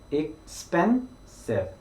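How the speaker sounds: expensive with the stress on the second syllable, spen. Shown as a demonstration. Teacher models expensive with the middle syllable stressed. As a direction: lean on spen in expensive, drawn out. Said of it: "스펜에 좀 더 강조를".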